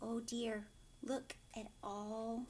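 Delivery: normal talking voice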